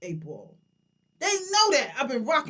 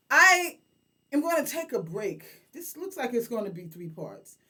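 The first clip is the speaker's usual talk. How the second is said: ordinary talk, nothing marked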